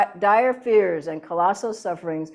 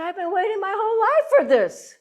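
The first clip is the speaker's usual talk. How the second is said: much higher and fast, breathless with eagerness; excited; 12 semitones higher, faster than usual. Retold or imitated. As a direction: burst out with lifelong longing. Quoting his own eager reaction.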